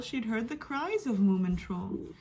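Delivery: plain reading voice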